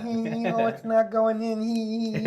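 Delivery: high, drawn-out camp tittering; mock giggling nurse. Nurse fumbling with the tube, mock camp giggle. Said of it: "He, he's going".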